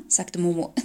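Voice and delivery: plain reading voice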